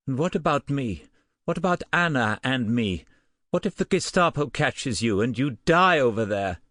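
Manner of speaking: rising fear for herself and Anna; fearful outburst. A narrator's reading.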